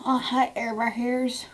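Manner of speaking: a hint of a sing-song greeting voice; slow, light put-on greeting; lightly silly voice. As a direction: greet the hairs like little pets. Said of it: "I'm talking like that".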